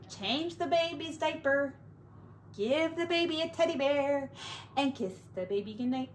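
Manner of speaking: ordinary delivery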